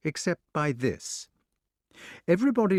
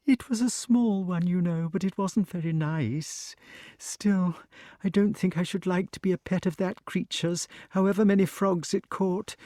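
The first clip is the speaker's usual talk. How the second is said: calming snake; 7 semitones higher than usual. A narrator's reading. Snake chats with Wart about toads, high and calmer. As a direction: settle down but stay a little wary.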